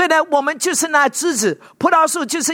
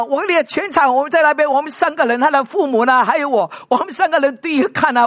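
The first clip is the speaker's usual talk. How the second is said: usual speaking voice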